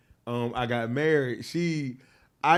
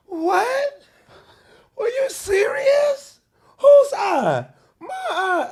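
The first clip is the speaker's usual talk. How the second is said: much higher, louder, near-crying mock panic; retold panic; 8 dB louder, much slower than usual. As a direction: shriek it, disbelieving and scared. Learning his eye hangs out.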